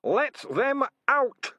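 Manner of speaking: very high, loud, slow shriek; screaming. A narrator's reading. Enraged rat screaming at the humans, very high and loud. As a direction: scream it with all his rage. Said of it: "Hammond Pork screamed".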